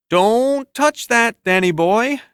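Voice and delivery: put-on slow, loud, drawn-out warning to a kid; a cocky neighbor telling a kid off; gruff warning. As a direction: lean on each word, talking around a cigar. Jim warns boy off car.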